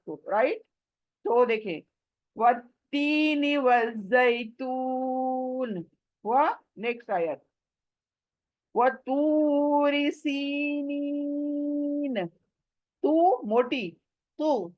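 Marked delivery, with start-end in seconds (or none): 2.4-5.8 slow, beautified Quranic recitation; melodic recitation. Shown as a demonstration. 8.8-12.3 वतूरी with the long u stretched, slow; drawn-out recitation. Shown as a demonstration. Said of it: "वाव मद्दा खींचना है".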